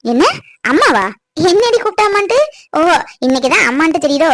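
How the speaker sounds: low, sharp, resentful reproach; bitter scolding. Acted. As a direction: scold her with hurt anger. Mother scolds daughter bitterly. (கோபமாக திட்டுதல்)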